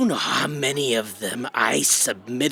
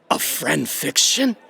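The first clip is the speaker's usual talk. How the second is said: ordinary talk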